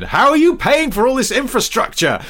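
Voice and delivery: hammed-up outraged challenge; mocking voice. Mock voice of a show quote.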